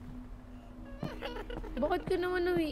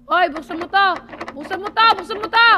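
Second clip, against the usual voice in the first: louder and higher each repeat; shouting; 4 semitones higher, 9 dB louder, faster than usual. Real, not acted. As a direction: yell to open the door, rising each time. Banging on locked door, yelling.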